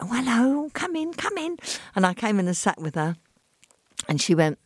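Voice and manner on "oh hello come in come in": imitated little lady, sing-song and very high. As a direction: welcome a visitor in a tiny high voice. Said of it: "this little lady opened the door".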